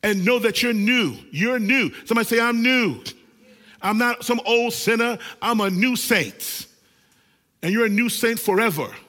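loud, fired-up preaching to the congregation; preaching at full force. Acted. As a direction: call out to the church with fire.

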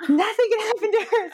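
high, protective burst; fierce determination, voice shooting up; like a mama bear guarding her cub. Retold or imitated. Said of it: "this mama bear erupted".

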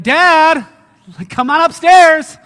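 loud, high shout up to Dad; excited calling. Acted. Excited kid calls Dad up to see the clean room, excitedly shouting. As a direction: holler for Dad, bursting with excitement. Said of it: "Like, you're just excited".

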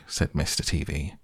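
plain reading voice

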